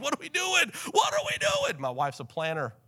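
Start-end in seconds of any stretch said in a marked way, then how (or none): 0.0-0.7 mock-frazzled, high-pitched question; worked up. Preacher acting out the couple getting worked up, high and frazzled. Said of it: "we get worked up". 0.9-1.8 squealing mock panic, pitch at the very top; hammed-up falsetto freak-out; comically worked up. Preacher acting out the couple getting worked up, squeaky mock panic. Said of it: "we get worked up".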